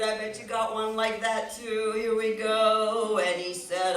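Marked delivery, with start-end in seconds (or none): none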